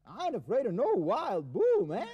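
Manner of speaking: put-on American accent. A cowboy guest boasts to the TV host in an American accent. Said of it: "what happened to your American accent".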